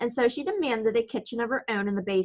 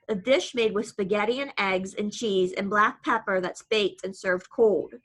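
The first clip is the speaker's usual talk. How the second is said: ordinary delivery, nothing marked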